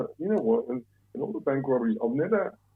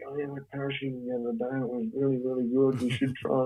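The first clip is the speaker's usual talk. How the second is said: lightly voiced keen parachutist, a bit higher; a mild imitation of an enthusiast, pitched up; 4 semitones higher than usual. Parachutists telling a bank robber to try it, lightly mimicked enthusiasm. Voicing people who boast of parachuting.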